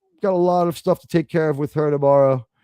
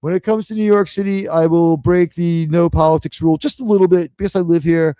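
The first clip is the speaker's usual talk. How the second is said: usual speaking voice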